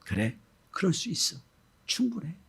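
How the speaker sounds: soft, hushed comfort; tender reassurance. Acted. God comforts Sarah, soft and understanding. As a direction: say it gently, almost whispered. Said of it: "다 이해하신다는 말씀이에요".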